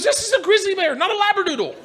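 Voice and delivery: high, indignant scolding; acted outraged reprimand; exasperated trainer. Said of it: "the trainer loses his shit".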